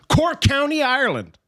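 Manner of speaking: high, hammed-up American accent on Cork County; mock American accent, overdone. Shown as a demonstration. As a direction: play the clueless American. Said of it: "in a kind of an American accent".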